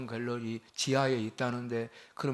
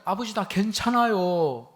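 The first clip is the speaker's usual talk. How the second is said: reassuring; 8 semitones higher, 4 dB louder, slower than usual. Retold or imitated. Son reassuring worried father.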